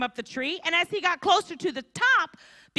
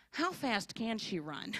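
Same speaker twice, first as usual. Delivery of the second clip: put-on quiet, slow sly little-boy thinking; scheming kid thought; 6 semitones lower, 12 dB quieter, slower than usual. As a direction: mutter it like a kid sizing up his mom.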